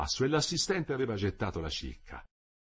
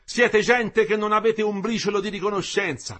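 loud, higher angry reproach; indignant tirade; 9 semitones higher, 7 dB louder than usual. A narrator's reading. The engineer keeps berating the workers indignantly. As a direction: scold them for ingratitude.

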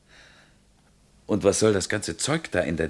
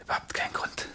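soft reassuring whisper; 4 dB quieter than usual. Acted. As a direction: whisper to calm her down. Calming partner in the dark.

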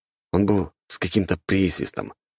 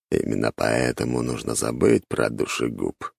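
a sly inner voice needling; whispery telepathic voice; 6 semitones lower than usual. A narrator's reading. Mor speaks inside the head.

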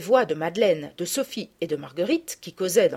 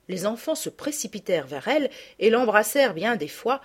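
plain reading voice

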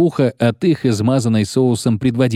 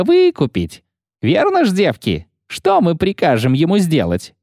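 rowdy guardswoman; 7 semitones higher than usual. Acted. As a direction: jeer at him like a rude soldier. Guardswomen taunting Geralt.

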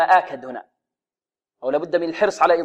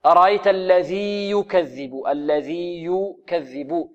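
teacher models the correct reading; slow model recitation; slower than usual. Shown as a demonstration. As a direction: recite slowly with a clear ي in الذي. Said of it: "الذال هنا معجمة".

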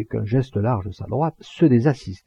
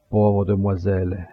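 slightly tearful condolence. A narrator's reading. Mourner offers condolences to the bereaved sister, mildly tearful. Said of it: "d'une voix mouillée".

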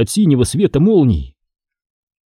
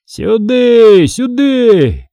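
high, drawn-out, louder hail; shouted call; 10 semitones higher, 5 dB louder, much slower than usual. A narrator's reading. Foma beckons riders through the storm, calling out. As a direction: call them over from afar. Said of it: "кричал Фома".

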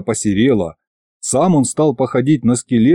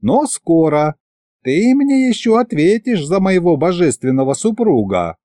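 threatening goddess voice; 7 semitones higher than usual. Acted.

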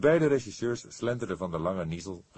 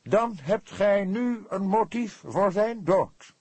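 slow, grand and much higher and louder; pompous; 8 dB louder, slower than usual. A narrator's reading. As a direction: pronounce it like a self-important old gentleman. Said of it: "sprak hij gezwollen".

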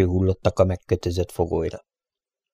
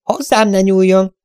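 high-pitched outcry of loathing; disgusted cry; 11 semitones higher, 4 dB louder than usual. A narrator's reading. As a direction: cry out in revulsion, pitched far up. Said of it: "Kiáltott fel a lány utálattal". (undorodó felkiáltás)

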